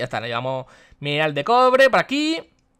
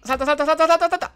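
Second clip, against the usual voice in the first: frantic urging; 11 semitones higher, 3 dB louder than usual. Real, not acted. Urgent escape jumping.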